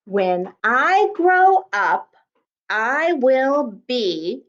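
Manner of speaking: very slow, deliberate word by word; slow read-back. Shown as a demonstration. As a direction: read it slowly, leaving the end open. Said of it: "I can't tell you the rest".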